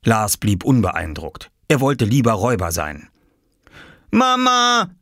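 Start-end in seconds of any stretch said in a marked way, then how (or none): 4.1-4.9 high, drawn call for mother; child calling out. Acted. Boy calls his mother for help, high child's call. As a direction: call her in a much higher boy's voice. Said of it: "rief Oskar".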